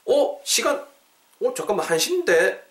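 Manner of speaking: high-pitched, puzzled double-take; suddenly notice the time; startled realization. Acted. Noticing it is already one o'clock, startled.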